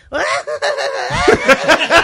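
loud, high imitated parrot cackle; parrot laughing. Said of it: "Y comenzaba a reírse".

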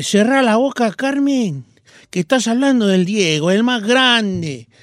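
mock Argentine scolding, slowing and dropping; Argentine accent. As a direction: retell it in a put-on Argentine accent. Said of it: "Con ese acento le dije yo".

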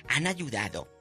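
ordinary talk

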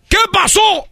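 high, sharp demand; alarmed father. Acted. Father demanding what happened. (padre alterado, pregunta aguda)

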